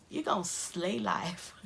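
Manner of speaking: put-on slow drawled American accent; a light American accent. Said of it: "I like to do an American accent".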